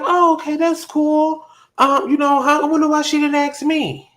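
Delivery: very high, light voice, acting unbothered; put-on high little voice, playing it cool; high-pitched feigned nonchalance. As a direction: retell your own reply way up high. Retelling her airy reply to coworker.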